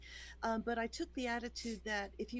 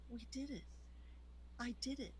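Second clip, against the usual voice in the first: soft, spaced-out words after long pauses; hushed realization; 11 dB quieter, faster than usual. Retold or imitated. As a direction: say it softly to yourself, letting it sink in. Recalling quiet moment of victory.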